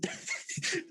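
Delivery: silent wheezing laugh; breathy laugh. Real, not acted. Laughing before sleigh story.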